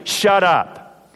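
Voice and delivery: firm, curt shut up; stern command. Acted. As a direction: command the demon with authority. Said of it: "but Jesus told the demon".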